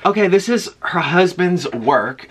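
ordinary talk, nothing marked

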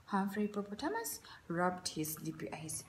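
usual speaking voice

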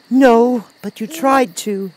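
put-on high-pitched indignant girl; sharp retort. A narrator's reading. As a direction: retort crossly as a little girl. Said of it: "she retorted".

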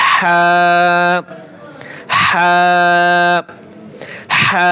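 হা from inside the throat with a hissing rasp; হা breathed raspy from the throat. Shown as a demonstration. Teacher leads students repeating the raspy হা. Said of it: "গলার ভিতরে খুব ফ্যাঁস ফ্যাঁস আওয়াজে".

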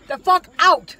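high, loud shout to get out; yelling at daughter to get out. Real, not acted. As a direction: bark her out of the car. Kicking daughter out of car.

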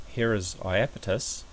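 usual speaking voice

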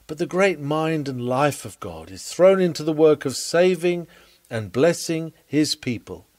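normal talking voice

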